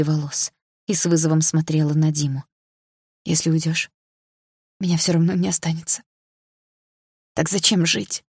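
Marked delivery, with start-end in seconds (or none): 3.2-8.2 hoarse question. A narrator's reading. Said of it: "хрипло спросила я".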